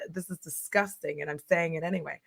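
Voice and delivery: quiet aside. Real, not acted. Aside about gross detail.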